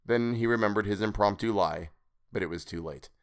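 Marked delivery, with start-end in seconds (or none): none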